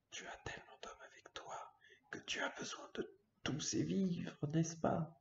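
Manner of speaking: hushed whisper, no voice. Acted. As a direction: breathe the words out. Reading character lines, whispered.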